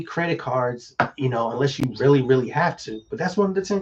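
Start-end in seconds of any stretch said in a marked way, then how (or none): none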